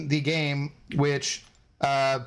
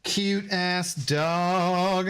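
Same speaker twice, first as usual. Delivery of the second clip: 1 drawn out coo; 2 semitones higher, much slower than usual. Real, not acted. Cooing at dog, drawn out.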